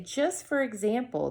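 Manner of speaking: usual speaking voice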